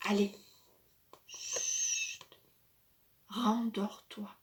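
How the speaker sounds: soft hushed lullaby voice, slowing; whispering over a crib. Acted. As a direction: soothe a baby to sleep. Child soothes toy to sleep.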